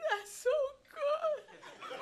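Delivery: very high, cracking, teary laugh; retelling being moved to tears by a song; choked-up laugh. Retold or imitated. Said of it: "laugh cry".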